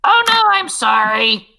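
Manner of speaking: fleeing goblin caught, crying out; frightened goblin cry. Acted. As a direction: wail an apology in fear. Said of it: "you hear him go".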